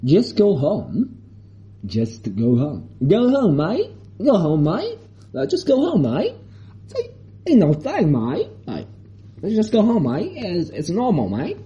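imitating a dismissive Australian policeman; Australian accent. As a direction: wave him off in a hammed-up Aussie accent. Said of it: "他澳大利亚口音说".

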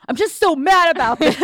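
loud, high hammed-up angry outburst; mock-raging shout; [name]'s mock fury. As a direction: overact being furious, pitch and volume way up. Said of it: "cuts to [name] going".

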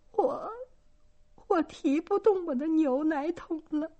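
put-on high, whimpering girl's voice near tears. A narrator's reading. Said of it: "轻轻地呻吟着说". (像挨打的孩子那样轻轻呻吟)

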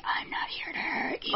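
soft, whispered imitation of the intruder; mock comforting whisper. Mocking how comforting the intruder's promise is, whispered. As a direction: whisper it as a creepy reassurance. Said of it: "Nothing's more comforting than hearing".

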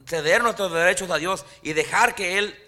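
full-force sermon climax; fiery preaching. Acted. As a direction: lift the congregation with conviction.

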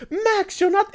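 exasperated mom parody, high and sharp; imitating the mom. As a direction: pitch it way up and mock the nagging mom. Mom scolding kid, quoted.

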